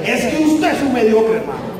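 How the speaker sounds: mock exasperated boss, high then dropping on the last word; boss's repeated rebuke. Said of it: "volví y me dijo".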